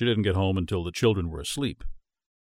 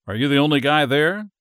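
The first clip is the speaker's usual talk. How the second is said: lightly voiced, louder teasing; a hint of playful wife; 6 semitones higher, 7 dB louder than usual. A narrator's reading. Julia teasing on phone.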